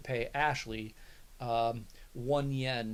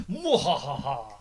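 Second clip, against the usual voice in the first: evil villain laugh; gloating mwahaha after a block; mad scientist; 9 dB louder, slower than usual. Pretend.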